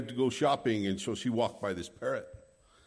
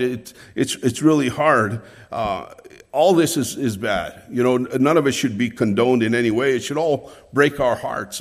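louder, passionate preaching; preaching with force; 2 semitones higher, 8 dB louder than usual. Acted. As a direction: drive it home from the pulpit.

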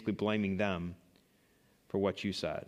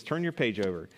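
ordinary delivery

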